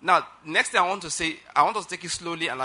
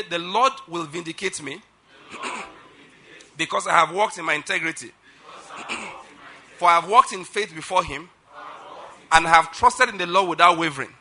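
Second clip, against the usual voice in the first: loud, measured proclamation with pauses; preaching declaration; 5 dB louder than usual. Acted. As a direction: declare it line by line for a congregation to repeat. Leads congregation in declaration.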